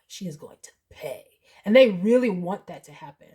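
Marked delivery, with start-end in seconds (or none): 0.1-1.2 low, hushed, grim insistence; adamant. Retold or imitated. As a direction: mutter it as a final verdict. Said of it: "she was so adamant".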